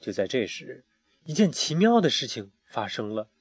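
ordinary delivery

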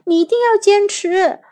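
high-pitched, insistent cry; urgent call. A narrator's reading. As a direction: urge a friend to hold on. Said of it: "杰克也大喊说".

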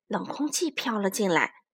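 usual speaking voice

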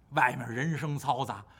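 ordinary delivery

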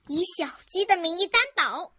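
shouting. Acted. Carmen swears on a chick's name, shouting. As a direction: shout it like a sworn oath. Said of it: "卡门喊道".

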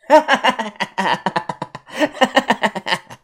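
a big delighted laugh at his nerve; booming laugh of a gang boss amused; hearty laugh. Acted. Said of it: "李康阳搂着我的肩膀大笑".

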